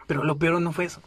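ordinary delivery

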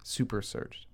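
"Super searched" breathed as a soft whisper; a whispered aside of "Super searched"; whispered echo. Real, not acted.

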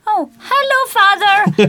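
play-acting picking up a call; pretend phone greeting. Friend pretending the ringing phone is her father, playfully.

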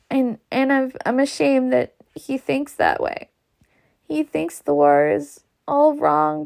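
mild weeping through the words; understated tearful plea; crying softly. A narrator's reading. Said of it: "she began to cry softly".

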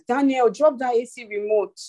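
a mother raising her pitch as her patience runs out; firmer mother. Retold or imitated. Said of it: "if I did not raise my voice".

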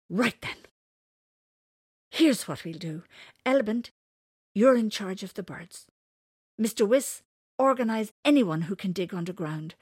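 loud, firm orders; commanding. Acted. J C commands the gathered creatures, loud and firm. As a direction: give orders like a leader.

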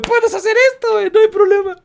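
high, loud thrilled woman voice; excited woman. Retold or imitated. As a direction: revel in newfound freedom.

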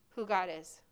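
usual speaking voice

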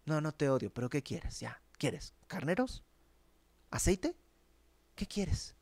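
soft, offhand mocking questions; dismissive sarcasm. The people brushing off God's complaint, in soft sarcasm. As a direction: brush God off with a sneer. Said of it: "estaban un poco con esa actitud".

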